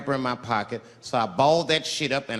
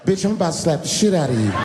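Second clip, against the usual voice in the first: soft sweet pimp voice; 2 semitones higher, 3 dB louder, faster than usual. Pretend. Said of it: "I said softly, sweetly, like a pimp might say".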